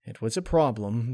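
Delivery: usual speaking voice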